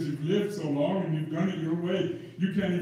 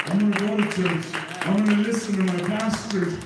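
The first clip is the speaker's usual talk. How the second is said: louder, rising vow to God; fervent vow; 4 dB louder than usual. Acted. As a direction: promise it with growing force. Preacher voicing his vow.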